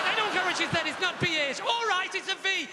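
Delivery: ordinary talk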